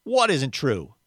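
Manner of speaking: put-out, resentful question; sulky. A narrator's reading. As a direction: demand it like a sulking man. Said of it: "asked the ghost sulkily".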